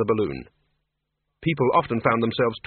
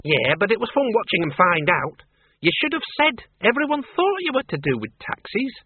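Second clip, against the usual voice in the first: higher, brisk country woman ranting; indignant old farm woman; 8 semitones higher than usual. Acted. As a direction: scorn the taxman like a stubborn farmer's daughter. Miss Flitworth amused.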